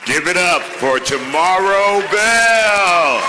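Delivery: slow, booming call to the crowd; loud emcee call. Real, not acted. Emcee hypes the crowd for the honoree, booming. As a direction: bring the honoree up with a big shout.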